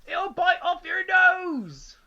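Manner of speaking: gleeful shout. A narrator's reading. Mister Twit yells a fake warning at his wife. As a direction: shout it out to terrify her. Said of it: "Mister Twit said".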